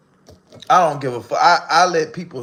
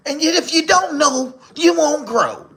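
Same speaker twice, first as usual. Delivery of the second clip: high, sing-song imitation of a motivational speaker; lightly mock Scar's little Bible-verse rhyming; mocking Scar's preachy rhyme; 11 semitones higher than usual. Mimicking Scar's motivational rhyme to viewers, high mock preacher voice. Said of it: "y'all ever know he go".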